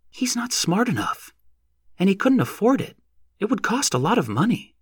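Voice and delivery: lightly voiced young woman, thoughtful; young woman's voice. A narrator's reading. Narrator voices Lossie.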